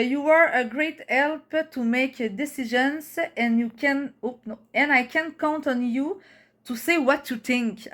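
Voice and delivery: English read slowly with a Québécois accent; careful reading in French-accented English; Québécois-accented English, drawn-out words; French-Canadian accent. Real, not acted. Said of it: "Jugez pas mon accent".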